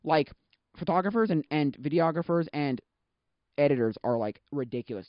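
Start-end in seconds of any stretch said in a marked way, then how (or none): none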